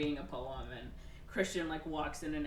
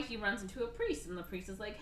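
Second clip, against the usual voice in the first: ordinary delivery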